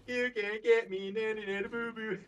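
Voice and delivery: high loud mocking taunt. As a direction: tease it like a playground bully. Taunting monster.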